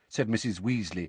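plain reading voice

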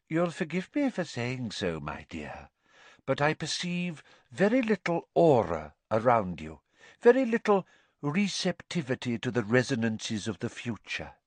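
airy, affected fortune-teller with mounting dislike; disdainful seer. Acted. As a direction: deliver a lofty put-down.

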